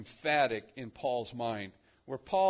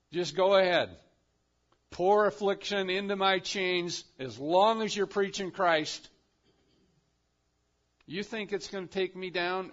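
louder, ringing defiance as Paul; defiant preaching; 4 semitones higher, 7 dB louder than usual. Acted. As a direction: throw the challenge out loud from the pulpit. Preacher voicing Paul's defiance.

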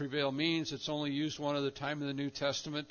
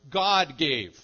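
"God" very loud and drawn out; stress on God; 12 dB louder than usual. Shown as a demonstration. Preacher pointing out subject and verb, forceful stress on "God". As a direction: pound out "God", slowly and forcefully. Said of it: "The emphasis is always on God".

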